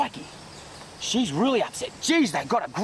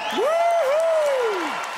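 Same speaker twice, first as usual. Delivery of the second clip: whoop; 4 dB louder than usual. Real, not acted. Showman whoop on stage.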